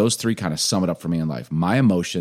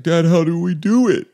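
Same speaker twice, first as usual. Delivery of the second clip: put-on higher, louder kid voice asking; young son; 10 semitones higher, 6 dB louder than usual. Son asks dad for help after praying, high earnest kid voice. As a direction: ask like an earnest little boy.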